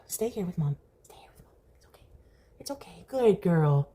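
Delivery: quiet tender murmur to a pet; soft coaxing. Real, not acted. Owner murmuring softly to keep the cat close. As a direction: hush her gently to stay.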